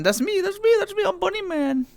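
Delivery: high squeaky little voice; cartoon bunny squeak.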